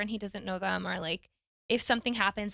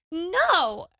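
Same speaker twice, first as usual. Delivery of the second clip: put-on higher stubborn toddler no; toddler refusing; 7 semitones higher, 5 dB louder than usual. Son refusing mom's suggestion, stubborn toddler no. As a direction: mimic a child's firm refusal. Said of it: "And then he goes".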